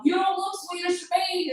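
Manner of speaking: high-pitched call from the back; mock heckler yell. Audience member heckling a comic's Swedish joke, high mock yell. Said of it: "this guy from the back yelled out".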